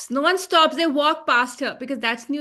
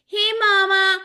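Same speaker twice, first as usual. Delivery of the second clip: higher and louder country twang, hammed up; mock cowboy greeting; 6 semitones higher, 4 dB louder than usual. Mocking the husband's cowboy reply to his wife. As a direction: tip your hat and drawl it. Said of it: "And he goes".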